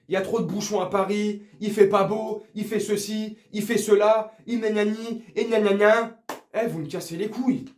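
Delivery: usual speaking voice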